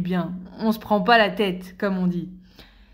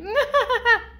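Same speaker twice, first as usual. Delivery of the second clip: very high-pitched silly laugh; put-on small-talk laugh; 13 semitones higher than usual. Colleague laughing at coffee-machine chat, high put-on laugh. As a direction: laugh up high at idle chitchat.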